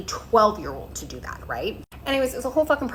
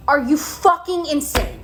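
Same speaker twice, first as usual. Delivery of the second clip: slow, loud, higher outburst of disbelief; exasperated; 5 semitones higher, 4 dB louder, slower than usual. Real, not acted. Fed-up parent demands answers from the insurer, slow and loud. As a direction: spit each word out, fed up.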